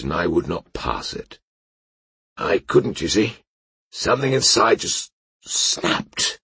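put-on a broken drunk confessing; ashamed drunk knight.

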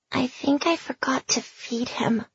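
soft, slow, guilty whisper. Acted. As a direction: whisper it like a sad child. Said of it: "she whispered".

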